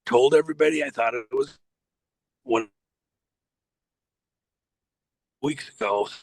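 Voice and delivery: choppy, breaking-up call line; words cutting out over a bad connection; glitching call audio. Real, not acted. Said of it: "you're glitching out".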